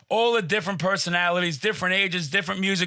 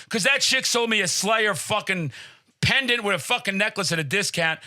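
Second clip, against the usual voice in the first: ordinary talk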